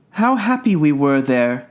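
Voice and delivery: lightly voiced children looking back; a touch of longing among playmates; wistful children. A narrator's reading. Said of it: "they said to each other".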